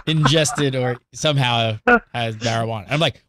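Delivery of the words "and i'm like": ordinary talk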